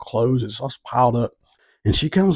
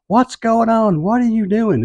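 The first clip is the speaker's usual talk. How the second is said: high-pitched frantic mock yell; retold yelling; 11 semitones higher than usual. Woman yelling from inside a swerving car, high retold yell. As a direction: imitate her yelling, pitch jumped way up. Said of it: "I could hear her yelling".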